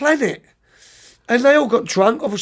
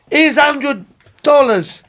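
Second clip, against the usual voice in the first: loud, high and slow, pushing the money; drunk generous coach; 6 semitones higher, 5 dB louder, slower than usual. Retold or imitated. Drunk coach tipping the minibus driver, loud and slow. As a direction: insist like a tipsy coach handing over cash.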